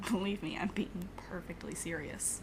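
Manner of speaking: breathy whisper with a laugh in it. Acted. Laughing off the call to be serious.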